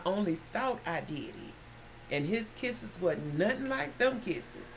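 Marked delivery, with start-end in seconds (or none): none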